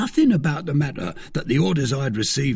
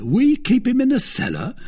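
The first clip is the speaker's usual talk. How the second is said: much higher, louder disbelieving squeal; shocked protest; 12 semitones higher, 4 dB louder than usual. A narrator's reading. Innkeeper protesting to D'Artagnan, high and shocked. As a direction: innkeeper aghast at the very idea.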